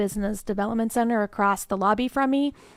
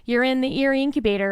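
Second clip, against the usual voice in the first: slightly higher, quicker, retold excitement on the phone; excited good news; 4 semitones higher than usual. Phoning a founder to say they got in, excitedly. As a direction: replay the happy call telling them they got in. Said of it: "call and get all excited and jazzed".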